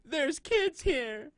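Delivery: high, drawn-out hysterical shout; hammed-up panicked hollering; mock yelling. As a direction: imitate a frantic crowd yelling. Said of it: "people yelling".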